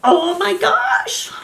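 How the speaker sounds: 1 stunned surprise. Retold or imitated. Reliving opening the box.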